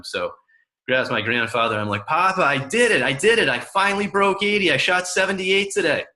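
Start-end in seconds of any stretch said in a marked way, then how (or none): 2.1-6.1 much higher, louder, overjoyed; bursting to tell grandpa the news; excited. Retold or imitated. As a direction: grandson calling grandpa on the phone, thrilled. Boy excitedly calls grandfather.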